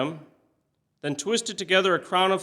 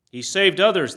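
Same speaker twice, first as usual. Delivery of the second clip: mocking jeer; 5 semitones higher, 6 dB louder than usual. A narrator's reading. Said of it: "mocked him".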